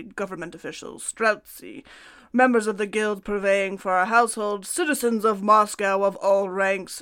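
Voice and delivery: put-on Russian accent. Host reading Peter the Great's decree to co-host, hammed-up Russian accent. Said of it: "That's my Russian accent".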